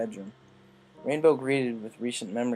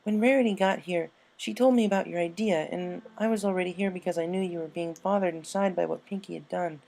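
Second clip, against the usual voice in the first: put-on higher, earnest young mare voice; Twilight; 8 semitones higher than usual. A narrator's reading. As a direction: explain it kindly, pitched up.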